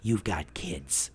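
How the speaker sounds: low, soft, slow reproach; mimicking his wife's stern low voice; wife's low scolding. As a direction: drop down and scold him slowly.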